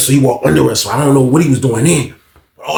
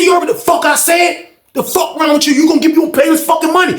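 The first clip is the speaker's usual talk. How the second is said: angry yelling; 11 semitones higher than usual. Retold or imitated. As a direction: yell it at him like you're about to swing. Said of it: "He said".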